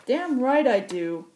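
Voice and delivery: high, loud, slow gruff shout; cocky old pilot calling out. Acted. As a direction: bark it back like a brash old pilot. Occam shouts from the cockpit.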